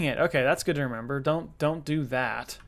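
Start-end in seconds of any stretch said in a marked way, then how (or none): none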